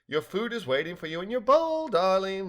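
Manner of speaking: high, lilting motherly call; sing-song. A narrator's reading. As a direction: lilt it teasingly like a doting mother. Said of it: "sing-song".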